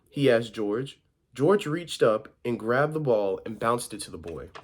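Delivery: animated storybook reading with stressed key words; story narration. A narrator's reading. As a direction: read a picture book out to children. Reads picture book aloud.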